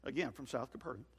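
ordinary talk, nothing marked

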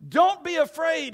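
high, loud reassuring call; commanding call. Acted. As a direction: call out over the storm. Jesus calls out over the sea.

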